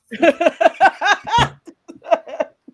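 loud, high burst of laughter; big laugh. Real, not acted. Host laughing at her failed Bajan, big laugh. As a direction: crack up loudly at your own attempt. Laughs at own accent attempt.

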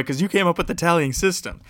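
ordinary talk, nothing marked

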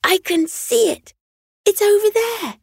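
put-on high, excited child calling out; girl spotting the star, calling out high; excited cry. A narrator's reading. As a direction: point and shout it with a child's glee. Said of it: "Astrid cried out".

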